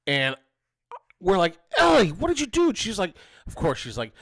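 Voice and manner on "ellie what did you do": loud, high-pitched mock scolding; alarmed parent. Parents confront daughter after slap, acted alarm. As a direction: act out a parent yelling in alarm.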